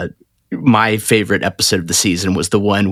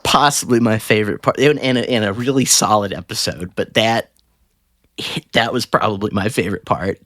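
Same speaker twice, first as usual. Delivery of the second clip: ordinary talk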